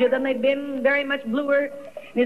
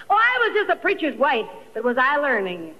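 usual speaking voice

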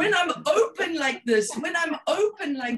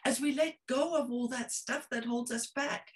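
ordinary talk, nothing marked

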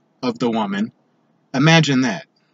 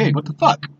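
imagined angry confrontation; 7 semitones higher than usual. Pretend. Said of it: "I'm just gonna be like".

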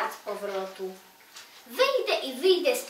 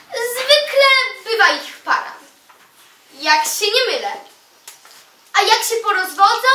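louder, higher, punchy comic recital; loud comic declamation; 6 semitones higher, 9 dB louder than usual. Acted. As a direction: belt out the funny verse to a crowd. Humorous poem declaimed at full force.

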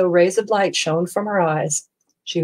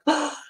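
gasp; 10 semitones higher, 5 dB quieter than usual. A narrator's reading. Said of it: "gasped Stellaluna".

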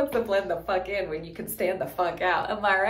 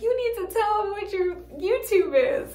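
laughing; 11 semitones higher than usual. Retold or imitated. Imitating Marissa's advice, laughing and higher. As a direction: quote her friend while laughing. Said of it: "She would be like".

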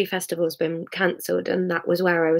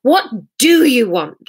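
raised, higher angry challenge; angry outburst; 5 semitones higher, 6 dB louder than usual. Acted. Sarah confronting Hamish, angry and louder. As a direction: fling the question at him. Drunk couple's tearful row.

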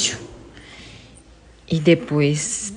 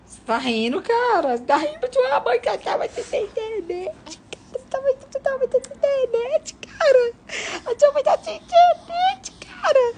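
much higher, laughing and teasing. Real, not acted. Mother joking to her laughing baby in a high squeaky voice.

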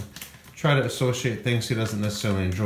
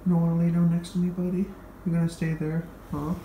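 high coaxing voice for a cat; coaxing pet talk; 6 semitones higher than usual. Real, not acted. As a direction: coax the kitty over, sing-song.

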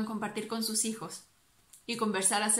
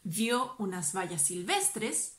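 plain reading voice

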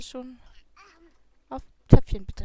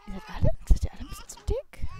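much higher, louder and fast; mock squeaky teasing voice; 11 semitones higher, 8 dB louder, much faster than usual. Player teasing the overeating Sims in a silly voice. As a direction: tease them in a silly put-on voice.

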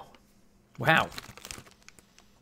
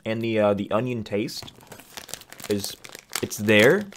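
speaking with food in mouth; words around a snack; talking while chewing. Real, not acted. Seen 1: Ate chip at -3, jaw still working during early words.

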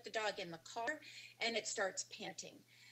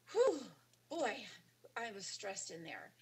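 mock relieved whew. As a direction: play the dog letting out its stress. Voicing relieved dog.

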